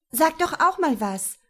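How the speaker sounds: high, loud prodding; urgent demand. A narrator's reading. Young woman prods her silent fiancé for support, demanding. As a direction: push them to speak up, exasperated. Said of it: "forderte Liliana ihn prompt auf".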